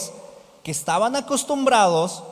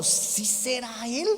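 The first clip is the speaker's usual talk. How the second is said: soft, slow, wondering question; hushed, drawn-out hesitant doubt; doubting disciple; 8 dB quieter, slower than usual. Acted. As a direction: ask it under the voice, unsure. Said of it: "Y es como".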